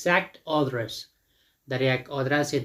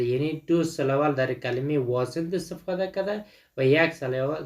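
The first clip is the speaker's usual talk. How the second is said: ordinary delivery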